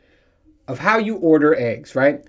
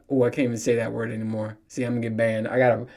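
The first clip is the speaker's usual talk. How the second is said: ordinary talk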